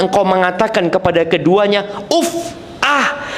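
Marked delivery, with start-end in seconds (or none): 2.1-3.2 high, soft, irritated huff at a parent; annoyed uff. Shown as a demonstration. Preacher shows a child huffing at parents, irritated. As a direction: sigh it out like a fed-up child. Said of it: "omongan yang kesel gitu loh".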